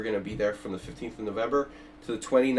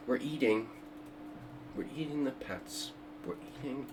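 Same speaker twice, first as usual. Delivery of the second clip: quiet Trump imitation; 9 dB quieter than usual. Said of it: "Trump's accent".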